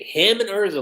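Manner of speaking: ordinary delivery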